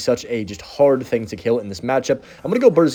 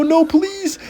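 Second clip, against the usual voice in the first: desperate plea. Real, not acted. Vines hit his troops.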